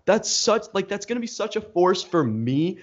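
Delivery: high, slow, disbelieving "what the hell"; a fed-up student retelling his outrage, slow and high; exasperated outrage. Retold or imitated. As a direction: pitch way up in mock disbelief. Exasperated retort to instructor.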